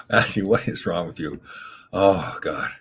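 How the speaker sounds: ordinary talk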